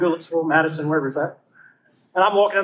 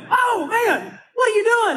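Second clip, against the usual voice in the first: much higher, panicked cry; startled yelp; 11 semitones higher, slower than usual. Retold or imitated. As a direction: jump when the flame hits. Lighter held under his hand.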